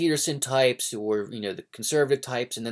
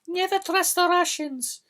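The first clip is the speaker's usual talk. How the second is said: much higher, put-on old grandma voice; grandmother's warning; 4 dB louder than usual. Grandson imitates grandmother warning him about Russians, high put-on voice. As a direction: squeak it out like a fussy old grandma. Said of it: "my grandmother always told me".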